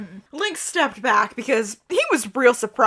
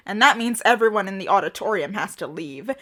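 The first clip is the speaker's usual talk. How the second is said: ordinary delivery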